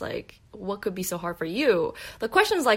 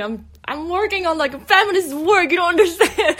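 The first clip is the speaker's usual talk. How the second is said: high, loud, laughing yell; inner scream; 11 semitones higher, 10 dB louder than usual. Retold or imitated. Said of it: "I'm, I'm screaming".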